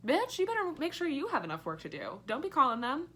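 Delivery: 1 sassy warning. Retold or imitated. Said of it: "I'm like".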